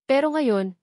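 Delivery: plain reading voice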